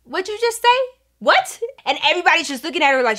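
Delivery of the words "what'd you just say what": very high-pitched disbelief; mock-incredulous, voice shooting way up; imitated shocked coach. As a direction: squeak out a stunned question as the coach. Coach's shock at the confession, retold.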